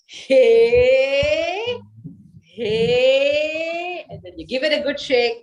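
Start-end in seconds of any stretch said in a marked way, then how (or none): 0.1-4.1 louder, higher playful hey hey; cheerful hey hey. Shown as a demonstration.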